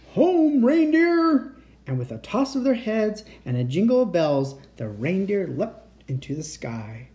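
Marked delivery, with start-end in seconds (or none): 0.1-1.5 high, loud, drawn-out call. A narrator's reading. Santa calling to his reindeer, loud and high. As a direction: sing out the command to the team. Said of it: "rubbed his eyes sleepily and called".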